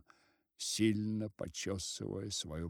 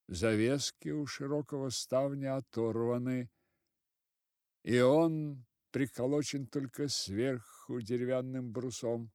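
ordinary delivery, nothing marked

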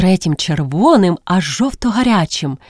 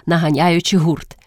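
usual speaking voice

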